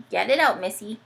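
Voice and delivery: ordinary delivery, nothing marked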